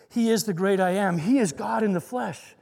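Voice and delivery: ordinary talk, nothing marked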